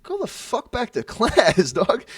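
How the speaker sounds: loud, high, drawn-out command; retold outburst with a laugh breaking in; angry order. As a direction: order him off, slow and forceful. Acted order to the kid.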